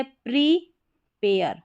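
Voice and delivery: "प्रीपेयर" drawn out slowly with "प्री"; slow model word. Shown as a demonstration. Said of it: "इसको प्री या प्रे करके पढ़ा जाएगा".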